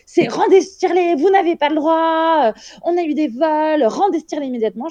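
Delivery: high, sharp mock scolding; high-pitched imitation of a bossy hospital worker; imitating angry staff. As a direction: demand it back like an officious nurse. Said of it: "en disant".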